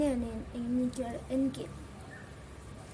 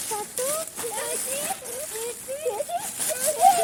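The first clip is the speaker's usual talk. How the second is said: calling out; 8 semitones higher than usual. Acted. Lost child to older sister in a strange place, calling out. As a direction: call for a missing big sister.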